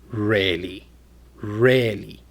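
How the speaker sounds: low, unimpressed-sounding "Really". Shown as a demonstration. Teacher demonstrating pitch changes meaning, low voice. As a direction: drop "Really" down deep. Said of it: "with a low voice".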